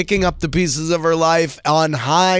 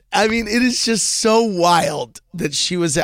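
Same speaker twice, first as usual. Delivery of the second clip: usual speaking voice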